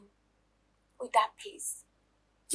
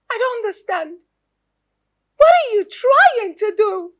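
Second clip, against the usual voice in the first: outraged; 10 semitones higher, 11 dB louder than usual. Acted. Wife's outrage rises.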